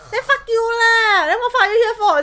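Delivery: high, loud, outraged retort; playfully furious shout at the partner; mock furious yell. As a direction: yell it back in mock fury.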